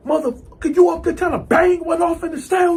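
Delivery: high-pitched outraged accusation; angry. Retold or imitated. Man turning on his bunkie in the cell, angry. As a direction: accuse him, rising into a high shout. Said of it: "I'm getting angry and angrier".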